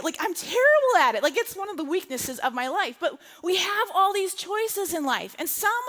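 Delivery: normal talking voice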